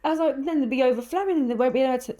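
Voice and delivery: high, hurried list of excuses; panicky excuses. Retold or imitated. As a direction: babble every reason not to stop. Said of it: "you gave me every reason".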